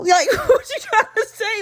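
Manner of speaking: high-pitched question through laughter; incredulous laughing. Real, not acted. Podcaster questioning a backhanded compliment, laughing incredulously. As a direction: laugh in disbelief as you ask.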